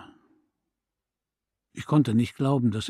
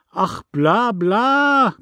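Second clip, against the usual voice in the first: high, loud mocking blah blah; mocking dismissal; 8 semitones higher, 6 dB louder, much slower than usual. A narrator's reading. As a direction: wave her off with scorn. Neighbour dismisses the mother.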